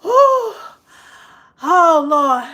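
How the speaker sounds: high loud exclamation after laughter; loud laughing oh Lord. Real, not acted. Winding down a laughing fit, loud oh Lord.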